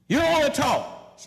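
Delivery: high, loud exasperated exclamation; mock outburst. Preacher mocking those who go silent, loud exasperation.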